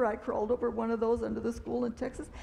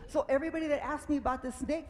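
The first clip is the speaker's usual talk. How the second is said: ordinary delivery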